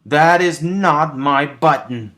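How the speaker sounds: loud, high, slow shout; Toad shouting. A narrator's reading. Toad shouts at Frog over the wrong button, loud high shout. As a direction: shout it in frustration. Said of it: "shouted Toad".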